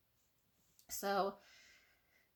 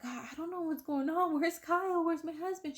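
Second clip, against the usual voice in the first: much higher, anxious retelling; acted worried confusion; 9 semitones higher, 3 dB louder than usual. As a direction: act out a bewildered patient pleading for answers. Confused patient asking for husband.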